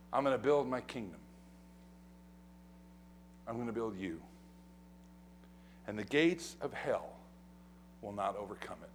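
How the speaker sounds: sermon falling to a hush; hushed promise. Acted. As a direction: bring it down to near whisper. Hushed after loud build.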